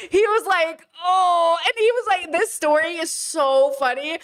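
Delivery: words squeezed out through laughter, high; laughing while talking. Real, not acted. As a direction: keep laughing as you speak.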